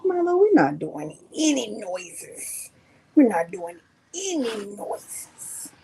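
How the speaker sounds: slow, deliberate telling-off; firm slow correction; slow firm scold. Real, not acted. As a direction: speak slowly to the pet.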